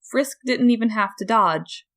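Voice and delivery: ordinary delivery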